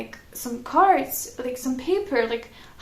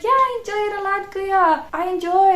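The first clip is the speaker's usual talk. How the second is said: polite reply; 7 semitones higher, 5 dB louder than usual. Retold or imitated. Her reply retold.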